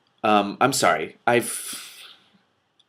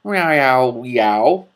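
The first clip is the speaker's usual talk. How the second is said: loud, high meowing; cat meows; 3 semitones higher, 8 dB louder, much slower than usual. Acted. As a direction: yowl like a cat.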